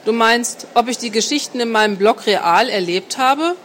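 ordinary delivery, nothing marked

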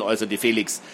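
ordinary delivery

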